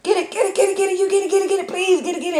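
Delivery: panicked, very high get it get it; shrieking at the spider in a car; frantic high-pitched squeal. Retold or imitated. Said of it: "I was just jumping around".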